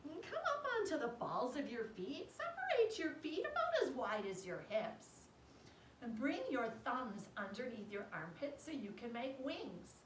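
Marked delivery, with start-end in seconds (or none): none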